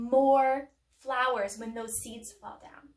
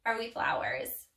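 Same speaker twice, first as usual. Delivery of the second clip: usual speaking voice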